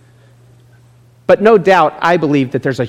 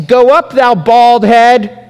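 high, slow, louder jeering taunt; mocking children; 8 semitones higher, 7 dB louder, much slower than usual. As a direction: sneer it like a mean kid on the road. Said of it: "They mocked him and they said".

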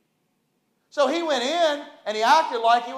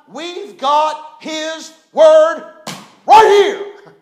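slow, high, ringing shout; slow, high shout over the music; preaching shout; 5 semitones higher, 13 dB louder, much slower than usual. Real, not acted. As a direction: proclaim it from the pulpit, drawn out. Preacher holding up the Bible.

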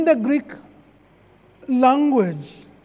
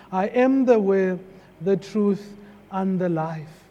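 soft scripture quote; 6 semitones lower, 5 dB quieter than usual. Retold or imitated. Pastor quoting Jesus to the congregation, softly. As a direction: read the words low and soft.

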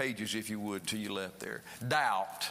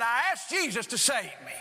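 higher-pitched joyful declaration; excited retelling; 6 semitones higher, 3 dB louder than usual. Retold or imitated. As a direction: share the news, elated. Retells her joyful conversion.

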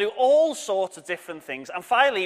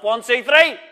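shouted count; 5 semitones higher, 8 dB louder than usual. Shown as a demonstration. Teacher cueing the oh no shout, loud count. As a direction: count louder, higher. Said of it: "I am gonna shout out and say".

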